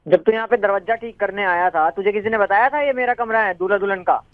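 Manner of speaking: the fed-up newlywed, pitched a little down; lightly voiced young groom.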